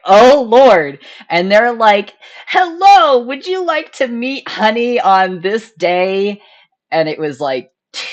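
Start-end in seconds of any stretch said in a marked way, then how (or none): 0.0-1.0 louder and higher, a thrilled gasp of Oh, Lord; loud excited exclamation. Retold or imitated. Reacting to the adoption email. 2.5-6.4 much higher, sing-song imitation of a polite message; mock cheery email voice. Voicing the adoption email.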